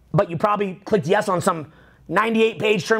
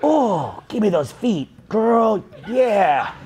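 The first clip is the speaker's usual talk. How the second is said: mock leering; much slower than usual.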